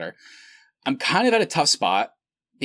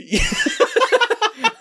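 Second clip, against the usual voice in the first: loud squealing burst of laughter; high shrieking laugh; 5 dB louder than usual. Real, not acted. Reaction to the voice impression.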